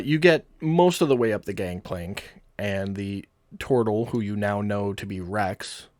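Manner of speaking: normal talking voice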